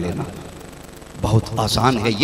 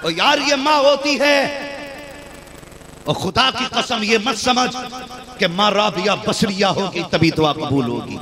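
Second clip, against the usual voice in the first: fervent preaching; 8 semitones higher than usual. Acted. Preacher drives the lesson home to listeners, fervently. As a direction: press the point home to the crowd.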